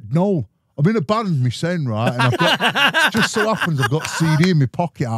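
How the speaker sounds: cheeky brother. Retold or imitated. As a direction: ham up the brother's sly plug. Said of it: "He's like".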